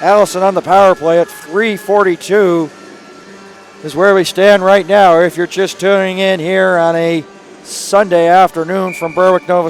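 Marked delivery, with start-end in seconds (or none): none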